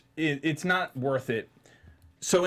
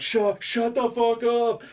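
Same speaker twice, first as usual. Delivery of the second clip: high, slow, exasperated demand; mock exasperated shushing; 10 semitones higher, 4 dB louder, slower than usual. Moviegoer half turning to talker, mock exasperation. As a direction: draw it out at someone behind you.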